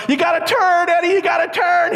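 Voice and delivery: high, urgent warning yell. Retold or imitated. Kids warning their friend to turn, frantic high yell. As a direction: scream the warning down a hill. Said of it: "We're yelling".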